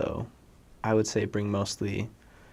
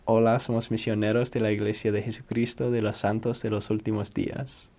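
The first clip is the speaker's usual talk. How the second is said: fluent Spanish accent in one quick run; flowing, practised Spanish accent from Spain; learned Spain Spanish accent; faster than usual. Shown as a demonstration. Missionary showing the accent they learned, Spanish accent. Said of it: "My best attempt at my accent".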